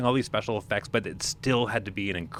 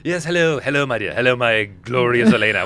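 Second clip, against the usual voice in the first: put-on English accent; 2 semitones higher, 5 dB louder than usual. Anthony Hopkins as Zorro in a hammed-up English accent. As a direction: ham up an English gent greeting Elena in English accent. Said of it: "he's talking in an English accent".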